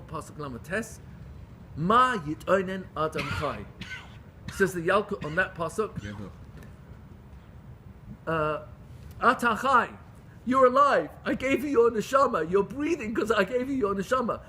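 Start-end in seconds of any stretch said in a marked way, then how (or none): 1.8-3.7 slow, deliberate verse citation; measured recitation. Retold or imitated. A teacher citing a verse from Eicha, measured recitation. First reading of the quoted verse. 9.2-14.4 raised, higher-pitched remonstrance; emphatic rebuke. Retold or imitated. God telling the complainer he is alive, forceful rebuke.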